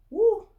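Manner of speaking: high loud shivering woo; cold shiver woo. Real, not acted. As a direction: yelp at the freezing memory.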